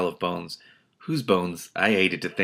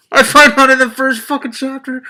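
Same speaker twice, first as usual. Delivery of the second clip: loud, high outburst of disbelief; exasperated shout; 12 dB louder than usual. Real, not acted. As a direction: yell it at the book in disbelief. Reacting to the book, shouting.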